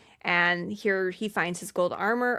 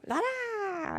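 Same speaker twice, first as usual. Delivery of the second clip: high sing-song goblin babble; much higher, softer la-la nonsense chant; goblin babbling; 11 semitones higher, 8 dB quieter than usual. Retold or imitated. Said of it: "Blix babbles in rhymes".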